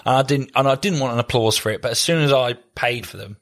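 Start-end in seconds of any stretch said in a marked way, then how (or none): none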